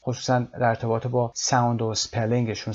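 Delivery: ordinary talk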